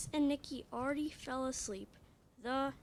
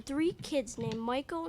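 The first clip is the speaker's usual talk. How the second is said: usual speaking voice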